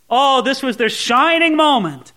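normal talking voice

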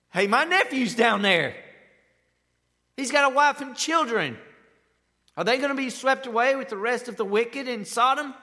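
high, loud worried voice; anxious inner thoughts. Retold or imitated. Preacher voicing Abraham fearing for his nephew, high and anxious. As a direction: fret about family down there, loudly. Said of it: "I'll tell you what I'd be thinking".

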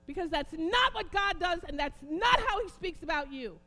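loud, high, impassioned sermon shouting; fiery preacher, hammering every phrase; preaching at full force. Real, not acted. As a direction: thunder the rebuke at the congregation.

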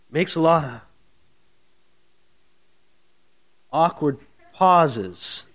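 long awkward pause before "awkward pauses". Shown as a demonstration. Preacher showing Paul as an awkward speaker, halting before "awkward pauses". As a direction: halt after "makes a lot of", then say "awkward pauses" slowly. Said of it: "maybe he was an awkward speaker up there".

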